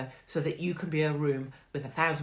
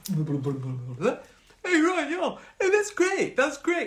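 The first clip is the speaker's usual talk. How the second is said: high, loud, over-eager cheer; excited dog owner gushing; eager posh man; 11 semitones higher, 6 dB louder, much slower than usual. Retold or imitated. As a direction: ham up a chirpy posh man.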